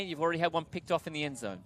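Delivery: ordinary delivery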